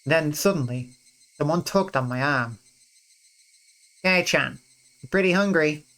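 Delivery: reading the story's narration and character lines aloud; visual novel reading. Acted. Player reading the game's festival scene aloud, lightly voiced. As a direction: read the scene out for the viewers, voicing each character lightly.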